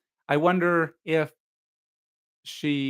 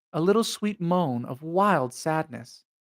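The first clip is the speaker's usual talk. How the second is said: ordinary delivery, nothing marked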